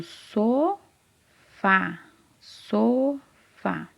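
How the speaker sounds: SOFÁ very slowly, SO held long, pause, FÁ; split into syllables. Shown as a demonstration. Teacher revealing the answer to a child, "sofá" drawn out by syllables. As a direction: stretch "sofá" into so... fá. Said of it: "começa com a sílaba so".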